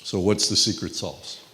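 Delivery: ordinary delivery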